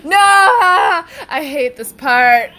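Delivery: very slow loud high wail; real fearful cry; dread. Real, not acted. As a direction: whine in dread. Dreading movie scene.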